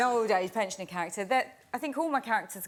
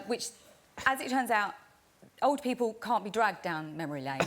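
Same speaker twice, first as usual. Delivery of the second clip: ordinary delivery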